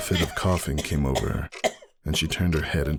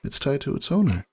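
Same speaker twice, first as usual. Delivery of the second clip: higher, gentle sick mare; Roseluck's voice; 11 semitones higher than usual. A narrator's reading. Roseluck apologizes to Ember from her hospital bed.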